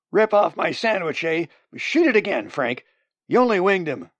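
loud, high, riled-up yell; indignant shouting. Acted. Robbed man urges Frank to shoot, angry shout. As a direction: shout for revenge, raising the pitch.

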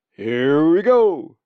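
long, loud, rising raven cry; croaking call. A narrator's reading. Zack calls out before diving with Molly, loud croak. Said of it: "croaked Zack".

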